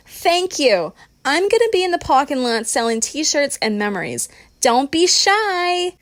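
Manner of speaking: high-pitched showboat sign-off, last words stretched long; performed holler. A narrator's reading. Christina thanks the crowd after her finale, hollering. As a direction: holler to your adoring crowd. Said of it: "and hollers".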